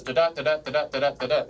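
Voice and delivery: quick "da da" pairs, second of each stressed; iambic beat. Shown as a demonstration. Teacher showing pentameter's rhythm, chanted "da da" in iambs. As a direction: tap out five quick weak-strong "da da" feet. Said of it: "this is iambic pentameter, so it goes".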